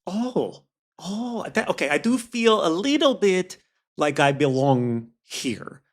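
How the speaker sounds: high, hesitant voice slowing down at the end; confused amnesiac stranger. Acted. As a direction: tentatively realise you might belong.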